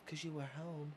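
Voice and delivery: soft, eerie killer voice; creepy killer voice. Retold or imitated. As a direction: deliver it cold like a masked killer. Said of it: "And she goes".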